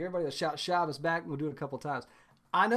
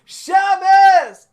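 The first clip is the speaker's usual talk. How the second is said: high, loud joyful shout; full shout; 12 semitones higher, 15 dB louder than usual. Shown as a demonstration. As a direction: shout it to the whole house. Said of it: "here we go. It goes like this".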